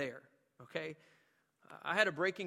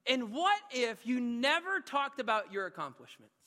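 raised, louder, urging questions; preaching challenge; 8 semitones higher, 5 dB louder than usual. Acted. Preacher challenging the church to drop their accomplishments, pressing. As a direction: push each question at the congregation.

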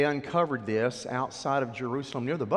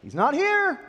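high, loud proclamation, much higher pitch; angel at the tomb; 9 dB louder than usual. Acted. Angel telling the women at the tomb, high and loud. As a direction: announce it like a heavenly messenger.

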